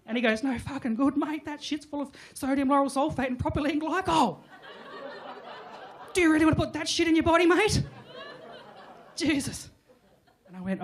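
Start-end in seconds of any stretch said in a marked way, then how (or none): 0.4-9.7 higher, louder, fast rant; hammed-up health-nut outrage; Darren ranting. Darren scolding the narrator about the Dolmio.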